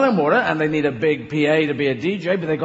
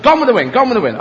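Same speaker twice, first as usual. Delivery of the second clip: louder, higher guessing shout; mock charades guess; 5 semitones higher, 6 dB louder, faster than usual. Guessing the dolphin's charade, loud and high. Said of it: "Always having a problem".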